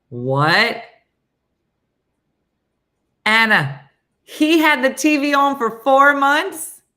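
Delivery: loud, high incredulous outburst; shocked disbelief. Retold or imitated. Reacting to son leaving TV on for months, shocked.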